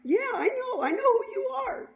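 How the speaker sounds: high, loud, insistent imitation; excited recognition. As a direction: insist eagerly that you recognize them. Acting out cashier insisting he knows.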